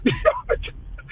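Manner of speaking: very high airless squeal of laughter; helpless squeaky giggle. Real, not acted. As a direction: break into a high wheezing laugh. Laughing at the Dora meme.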